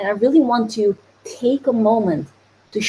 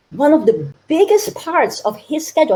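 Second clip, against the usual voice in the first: ordinary talk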